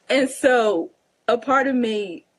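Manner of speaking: ordinary talk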